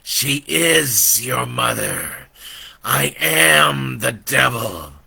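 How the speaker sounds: slow devil voice building to a loud roar; insist, then explode; angry devil. Acted. Devil insists to Brandon, slow then roaring.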